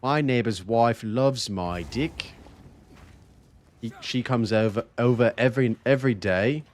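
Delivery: hammed-up fake British accent; bad British accent. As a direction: try a British accent and miss. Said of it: "that's probably, like, bad British".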